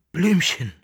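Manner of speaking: pressed, tight voice, high and loud; strangled voice. A narrator's reading. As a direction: squeeze the name out. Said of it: "mit gepresster Stimme".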